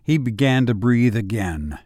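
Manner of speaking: ordinary delivery, nothing marked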